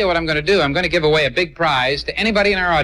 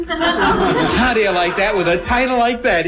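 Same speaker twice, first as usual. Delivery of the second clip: amused, laughing, higher and slower; 5 semitones higher than usual. Real, not acted. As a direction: chuckle in delighted disbelief. Host laughs at the Hoboken twist.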